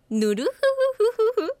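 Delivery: Koro-sensei laugh. Acted. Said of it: "コロ先生の笑い方".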